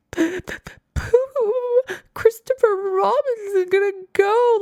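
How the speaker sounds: high, choked, near-crying wail; teary. Retold or imitated. Recalling watching the Pooh movie, high and close to tears. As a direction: let the voice crack like the tears are coming.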